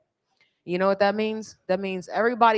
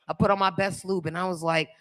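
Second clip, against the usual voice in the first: normal talking voice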